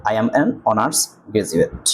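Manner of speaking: slow demo reading. Shown as a demonstration. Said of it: "N বসাই".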